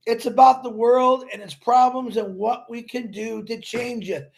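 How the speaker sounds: ordinary talk, nothing marked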